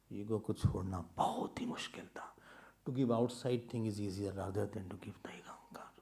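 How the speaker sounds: hushed whisper; a whispered aside to the audience. Real, not acted. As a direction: breathe it like a secret. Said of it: "whispered lines".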